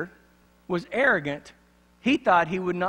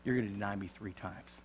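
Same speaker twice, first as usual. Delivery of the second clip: quiet warning; 7 semitones lower, 9 dB quieter than usual. Retold or imitated. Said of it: "and he said".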